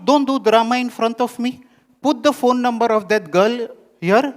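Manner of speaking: put-on high, loud scolding mother with a Middle Eastern accent; brisk Middle Eastern accented mother waving him off. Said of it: "she has this Middle Eastern accent".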